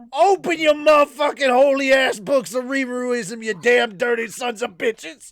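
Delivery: high, shouted hellfire sermon; screaming preacher. Acted. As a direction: bellow it at the congregation. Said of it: "I'm just gonna be like".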